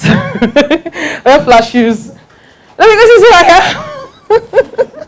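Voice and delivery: high, drawn-out words through laughter; laughing. Real, not acted. Joking with the audience about wearing flat shoes, laughing. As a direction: break up laughing while talking.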